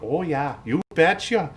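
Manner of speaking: high, loud mock Wisconsin accent. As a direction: put on a folksy Wisconsin voice. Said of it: "makes me think of Wisconsin".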